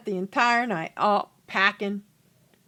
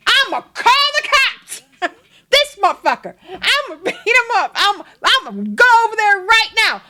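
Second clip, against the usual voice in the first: high, loud hammed-up rage; going off; 10 semitones higher, 7 dB louder than usual. Jealous husband hearing of a pass, hammed-up ranting. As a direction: blow up like an outraged husband, shouting. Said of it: "he's just going off".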